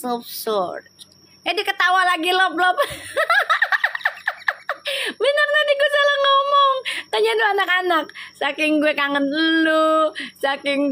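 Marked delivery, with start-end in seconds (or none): 2.8-5.2 a sudden high squealing burst of laughter; high-pitched giggling shriek. Real, not acted. Laughing at her own slip. 5.2-8.0 embarrassed, giggly protest, pitched up; laughing embarrassment. Real, not acted. Laughing at her own slip.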